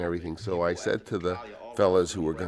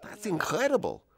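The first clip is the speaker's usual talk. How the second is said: high, amazed exclamation; astounded. Retold or imitated. Studio boss seeing Brando's transformation, high amazed exclamation. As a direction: gasp it out in disbelief. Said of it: "Charlie is just looks astounded and he says".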